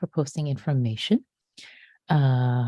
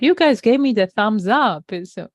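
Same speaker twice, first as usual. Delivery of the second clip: playful fake complaint, louder; mock indignant; 5 semitones higher, 7 dB louder than usual. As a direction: pretend to be wronged, teasing. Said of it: "Just kidding".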